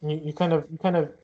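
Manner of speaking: normal talking voice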